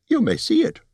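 hasty Bard calmed by a high squeaky hobbit; 高い声でなだめるビルボ. Acted. Bilbo reveals stone.

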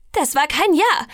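protesting. A narrator's reading. Bella denies she agreed to anything, protesting. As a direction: object sharply and emphatically.

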